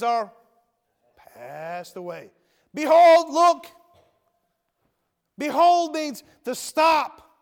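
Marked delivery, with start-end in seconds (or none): 2.7-3.7 ringing high Behold, look; loud call. Acted. Preacher calling out scripture. 5.4-7.3 high, slow, shouted Behold. Acted. Preacher shouting a definition.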